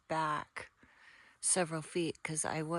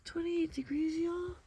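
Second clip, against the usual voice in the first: surprised; 11 semitones higher, 4 dB louder than usual. Real, not acted. Surprised at the cold.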